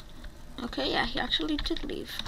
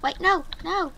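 pleading; 7 semitones higher than usual. Real, not acted. Player begging another player to stay, high pleading. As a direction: beg them to stay.

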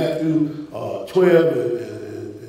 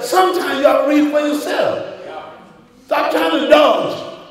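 preaching at full force; 6 semitones higher than usual. Acted. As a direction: hammer the point to the congregation.

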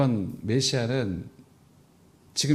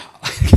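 loud laugh; 11 dB louder than usual. Real, not acted. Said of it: "이렇게 해서 뭐".

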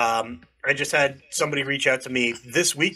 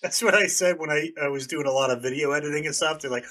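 ordinary talk, nothing marked

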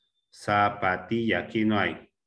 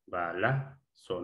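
"Balazo" slow and softer, in separate pieces; syllable by syllable; 3 semitones lower, 5 dB quieter, slower than usual. Shown as a demonstration. Teacher rechecking the stressed syllable, syllable by syllable. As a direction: quietly sound out "Balazo" bit by bit. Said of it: "la sílaba tónica".

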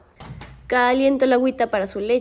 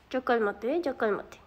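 much quieter, hushed; soft calming; 10 dB quieter than usual. Real, not acted. Woman calming her overexcited dog, soft hushed voice. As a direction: settle it down softly.

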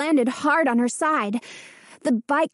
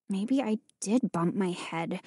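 lower, softer muttering; 4 semitones lower, 7 dB quieter than usual. A narrator's reading. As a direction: mutter it to herself. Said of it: "Hannah muttered".

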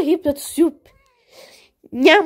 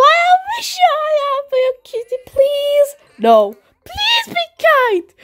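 pleading; 11 semitones higher than usual. Pretend. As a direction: beg in a squeaky high voice. Toy begging for the kitty.

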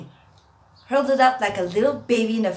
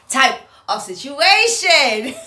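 slow, louder, laughing through the words; laughing catchphrase; 5 semitones higher, 9 dB louder, much slower than usual. Real, not acted. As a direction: drag the catchphrase out while laughing. Repeated catchphrase, laughed out slowly.

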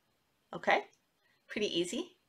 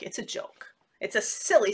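ordinary talk, nothing marked

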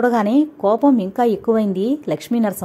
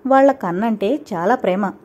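usual speaking voice

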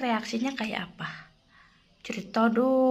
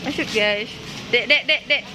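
normal talking voice

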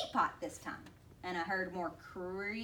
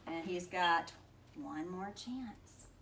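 ordinary delivery, nothing marked

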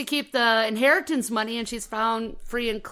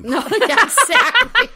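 laughing; 11 semitones higher, 11 dB louder than usual. Real, not acted. Bursts out laughing at cake joke.

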